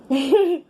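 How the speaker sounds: high laughing burst; burst of laughter. Real, not acted. Recalling the dumb question, a high laugh. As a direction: laugh out at the memory.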